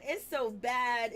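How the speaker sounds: slow emphasis. Real, not acted. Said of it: "repeats for emphasis".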